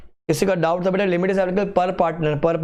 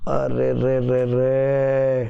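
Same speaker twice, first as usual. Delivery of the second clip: mock dismayed are re re; 4 semitones lower, much slower than usual. Mocking wrong answer.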